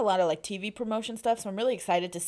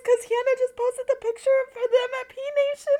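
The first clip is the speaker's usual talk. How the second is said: high, loud excited outburst; real excitement; 8 dB louder than usual. Real, not acted. Breaking live news to the cohosts, excited. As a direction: burst out with breaking news, pitched way up.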